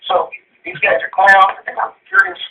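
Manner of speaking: real anger, pitch jumping high on furious; a caller boiling over at his senator; fired-up fury. Real, not acted. Radio caller fired up at his senator, real furious outburst. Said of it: "I'm getting pretty fired up".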